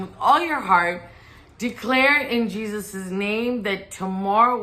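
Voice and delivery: fervent preaching call. Acted. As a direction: urge them to declare with all their heart. Leads viewers in declaring.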